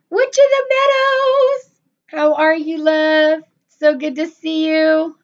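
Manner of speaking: high, loud drawn-out name call; delighted high greeting; excited greeting. Real, not acted. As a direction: hail a favourite viewer joyfully. Greeting arriving viewer.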